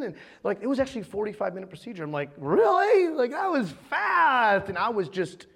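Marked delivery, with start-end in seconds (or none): 2.4-4.7 high, drawn-out dazed amazement; mock a drugged patient, stretching the words in surprise. Patient hearing it took forty-five minutes, woozy disbelief. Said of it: "I was wild and out of it".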